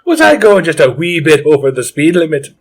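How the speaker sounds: lightly put-on Irish accent, innocent tone; mock Irish accent. Leprechaun to the cop who stopped him, light Irish accent. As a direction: play the wee leprechaun in Irish, coy and innocent. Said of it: "if our Irish accents are bad".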